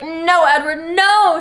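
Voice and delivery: hammed-up heroine wail; mock howl. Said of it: "She howled at me".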